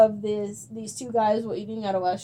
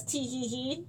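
mock giggle; 12 dB quieter, slower than usual. Fake innocent giggle after stirring drama.

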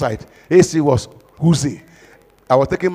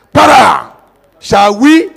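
mock shout; 5 semitones higher, 6 dB louder, slower than usual. Moses striking the rock.

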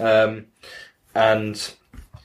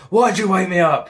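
mock angry dad; 10 semitones higher, 4 dB louder than usual. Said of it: "He's like".

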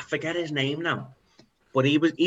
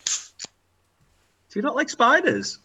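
teasing; 12 semitones higher, much slower than usual. Acted. Teasing scared friend.

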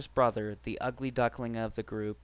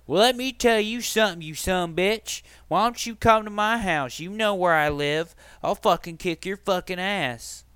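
imitating a threatening yell, higher and louder; hammed-up threat to come fight; furious brother; 6 semitones higher, 5 dB louder than usual. Brother threatening a fight on the phone, mock yell. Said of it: "and is like this".

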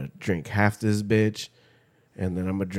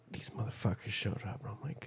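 hushed whisper of dismay; 17 dB quieter, faster than usual. Retold or imitated. Whispering to a friend about unwanted visitors. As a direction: breathe it out unvoiced.

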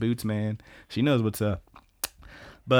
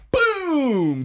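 high, drawn-out celebratory boom; triumphant cheer; 4 dB louder than usual. Real, not acted. Podcaster celebrating the heroine's comeback, triumphant cheer. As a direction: let it explode upward like a big cheer.

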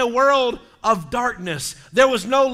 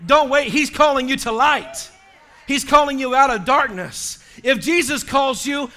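higher, raised exhorting preacher voice; forceful preaching; 4 semitones higher, 4 dB louder than usual. Real, not acted. Preacher urging people to answer the call, forceful preaching. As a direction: urge the congregation to act.

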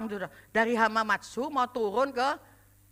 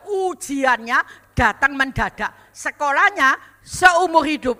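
louder and slower, each line hammered down; slow emphatic proclamation; 8 dB louder, slower than usual. Shown as a demonstration. Preacher repeating the saying to the church, slow and loud.

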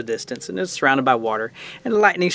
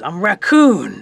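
raised, loud wail of self-introduction; plaintive little raccoon; 5 semitones higher, 6 dB louder, much slower than usual. Acted. Little creature telling Bear who he is, plaintive. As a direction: protest like a small wounded creature. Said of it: "the little creature says".

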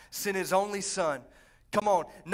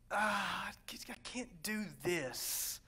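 mock defeated sigh; 2 semitones lower, 9 dB quieter, much slower than usual. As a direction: sigh and drag it out as if giving up. Acting out giving up.

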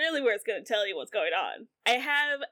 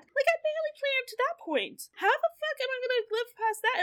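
high-pitched exasperated rant; comic panic; 11 semitones higher than usual. Real, not acted. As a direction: voice shoots up in mock despair. Comic panic about life after fifty.

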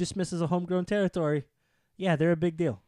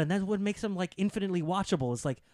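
ordinary talk

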